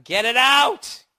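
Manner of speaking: loud, high, drawn-out plea; frantic demand. Acted. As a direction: yell it, rising and stretched out. Acting horrified patient begging doctor.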